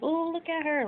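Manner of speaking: high, admiring ooh; sister's admiring coo. Retold or imitated. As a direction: gush over her like the sister did. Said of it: "my sister was like".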